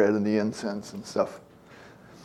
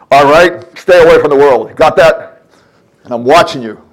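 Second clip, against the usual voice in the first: loud, higher commanding voice barking orders; bullhorn God; 4 semitones higher, 13 dB louder than usual. Pretend. God ordering his people away from the world, loud bullhorn bark. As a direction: bark it at them like through a bullhorn. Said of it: "He doesn't go through the bullhorn".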